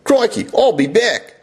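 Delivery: movie catchphrase in broad Australian; Arnold Schwarzenegger. Acted. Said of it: "Arnold Schwarzenegger had an Aussie accent".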